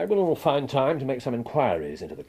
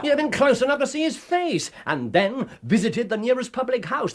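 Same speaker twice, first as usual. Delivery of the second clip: exasperated; 12 semitones higher, 3 dB louder than usual. Acted. Holmes scolds Watson sharply.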